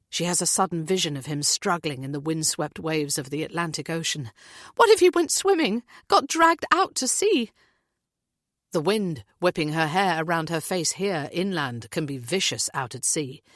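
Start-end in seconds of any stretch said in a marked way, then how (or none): 4.8-7.5 high, loud, frantic mother voice; a mother's voice rising in panic; panicked mother. A narrator's reading. Heather fears son drowned.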